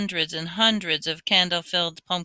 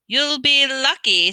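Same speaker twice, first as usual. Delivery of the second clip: high, slow, loud mocking poltergeist; cackling voice; 7 semitones higher, 6 dB louder, slower than usual. A narrator's reading. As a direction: gloat over the trouble. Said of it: "said a cackling voice".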